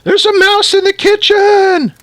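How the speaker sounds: slow, very high, loud shriek; panicked shout. A narrator's reading. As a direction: raise the alarm in a drawn-out screech. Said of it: "shouted Missus Newcomb".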